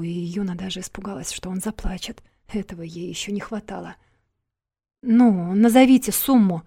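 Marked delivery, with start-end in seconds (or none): 5.0-6.6 loud, insistent push for an answer; demanding. A narrator's reading. Yuna trying to bring the man round, demanding. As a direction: press hard, raising the volume. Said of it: "потребовала она".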